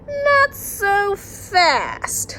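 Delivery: put-on drawn-out, high, far-off big kid. Big kid from three oh nine warning the younger kids, drawn out and high. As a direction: stretch it out like a know-it-all older kid.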